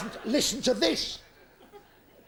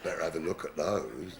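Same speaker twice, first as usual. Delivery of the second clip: lecherous vicar; 5 dB quieter than usual. Acted.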